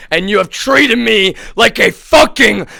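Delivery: outraged scream; furious shout. Real, not acted. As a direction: hurl the accusation. Angry accusation.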